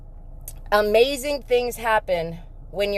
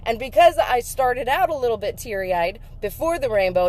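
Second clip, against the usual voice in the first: ordinary talk, nothing marked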